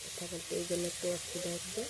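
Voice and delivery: real hoarse voice, a little strained; talking through a sore throat; hoarse from a cold. Real, not acted. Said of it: "আমার গলাটা বসে গেছে".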